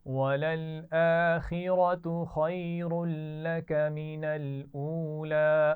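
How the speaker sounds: teacher chanting Quran for students to echo; Quranic recitation. Shown as a demonstration. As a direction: model the verse slowly for the class to repeat. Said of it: "एक दफ़ा फिर पढ़ते हैं".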